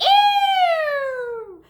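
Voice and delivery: loud high drawn-out squeal; hog squeal. Acted. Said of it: "who screamed".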